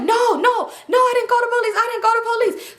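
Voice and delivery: high, shouting, panicked protest; panicked denial. Retold or imitated. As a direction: imitate a scared clerk yelling no. Motel guy denying loudly.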